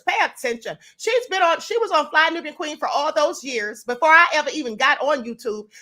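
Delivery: ordinary talk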